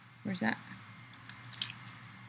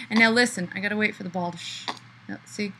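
ordinary talk, nothing marked

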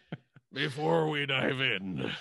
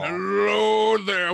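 devilish greeting; 6 semitones higher, 8 dB louder, slower than usual. Acted.

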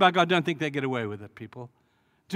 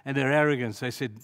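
ordinary delivery

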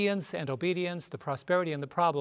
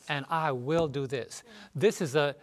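usual speaking voice

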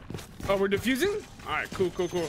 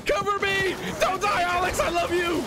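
high, urgent calling to teammates; worked-up gamer callouts; excited battle calls; 5 semitones higher than usual. Real, not acted. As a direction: call out mid-fight, rushing to save a friend. Calls out during rescue fight.

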